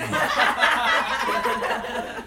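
airy high-pitched laugh; breathy high laugh. Real, not acted. Laughing at own joke.

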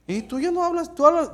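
high-pitched mimicking voice; mock challenger. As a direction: put on a high questioning voice. Imitates someone challenging his new speech.

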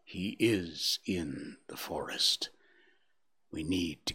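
slow, breathy near-whisper; strange hushed inflection. A narrator's reading. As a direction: hush it, as if afraid to name him. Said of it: "repeated with a strange inflection".

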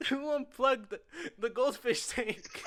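high, slowed words broken by laughter; can't get it out for laughing; giggling through a fake-innocent question. Laughing while retelling own question.

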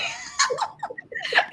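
real squealing laughter; high giddy laugh. Real, not acted.

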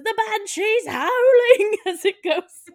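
high, loud, drawn-out Irish cry; mock Irish accent. Bus driver Eamon as the bus careens downhill, loud mock Irish cry. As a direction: wail it in a hammed-up Irish accent. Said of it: "this accent is not gonna be good".

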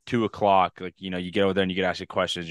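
ordinary talk

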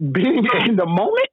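incredulous echo. Real, not acted. Co-host questioning the guest's answer about French, incredulous. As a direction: repeat it back in disbelief, drawn out. Mockingly repeats the other man's answer.

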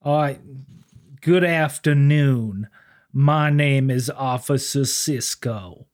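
slow, smooth, overly polite officer. Retold or imitated. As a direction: play a humble, courtly lawman. S0 acts Officer Sisko, smooth voice.

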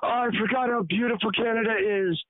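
high, mock-awed traveler voice; mock returning traveler. Friend back from a month in Vietnam, mock awe. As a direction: imitate a friend gushing over home, pitched high. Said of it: "She came back and she goes".

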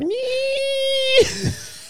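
sudden high-pitched laugh. Real, not acted. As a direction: burst out in a squeaky laugh. Laughs at own joke.

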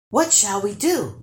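ordinary delivery

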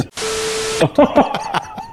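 long high laughing fit; laughs. Real, not acted. Laughing at his own joke.

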